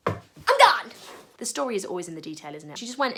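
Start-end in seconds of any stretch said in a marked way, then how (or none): 0.1-0.9 loud burst of I'm done; excited shout. Acted. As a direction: yell it as you drop everything. Woman drops jar and runs.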